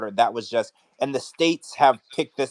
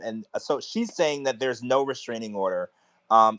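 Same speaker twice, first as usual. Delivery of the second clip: ordinary talk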